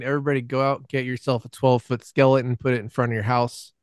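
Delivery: ordinary delivery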